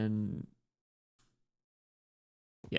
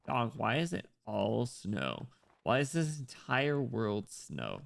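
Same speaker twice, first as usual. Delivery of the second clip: usual speaking voice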